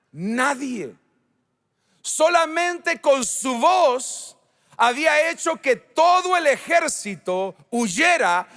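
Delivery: high, forceful pulpit delivery; impassioned preaching. Real, not acted. (predicación apasionada)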